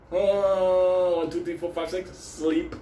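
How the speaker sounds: high, laughing count to a slow knockout; counting punches. Retold or imitated. Punching one of the women, gleeful count.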